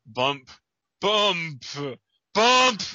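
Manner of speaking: thump it out, growing to a shout; building heartbeat bumps. Pretend. Heartbeat sound effect.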